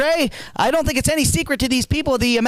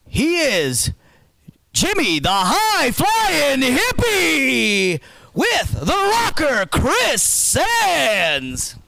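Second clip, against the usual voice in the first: drawn-out ring announcement; much slower than usual. Acted. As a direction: stretch every word in a booming intro.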